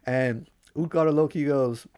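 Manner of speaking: plain reading voice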